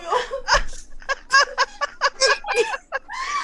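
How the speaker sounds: long, high-pitched peals of laughter; soft, high, uncontrollable giggling; helpless laughing fit. Real, not acted. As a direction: squeal with real laughter.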